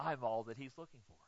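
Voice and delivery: usual speaking voice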